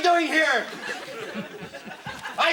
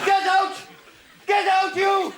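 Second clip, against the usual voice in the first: shouted order; angry shout; 5 semitones higher than usual. Acted. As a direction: bellow them out the door. Ordering the ex-lover out.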